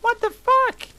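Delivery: very high, loud squeal; hammed-up shrieking fury; angry shout. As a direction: shriek it in outrage. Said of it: "I shouted angrily".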